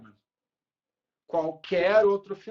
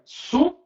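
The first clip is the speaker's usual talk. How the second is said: "su" alone, punched higher and louder; tonic syllable; 9 semitones higher, 6 dB louder than usual. Shown as a demonstration. Teacher isolating the tonic syllable for the class, "su" high and loud. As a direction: hit "su" as the strong syllable. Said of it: "sílaba tônica".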